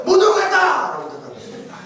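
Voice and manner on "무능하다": blunt shout. Retold or imitated. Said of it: "대답했어요".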